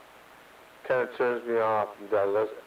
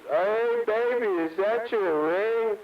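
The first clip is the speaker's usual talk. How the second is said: higher and slow, put-on lady asking sweetly; mock woman's voice; 8 semitones higher, slower than usual. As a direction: play the girlfriend, pitched up and drawn out. Man voicing a woman's question.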